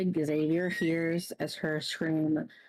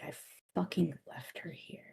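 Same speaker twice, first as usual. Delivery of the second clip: whispering; 6 dB quieter, faster than usual. Acted. Whispered despair after scream.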